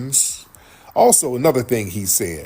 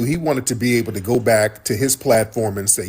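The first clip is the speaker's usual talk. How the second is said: ordinary delivery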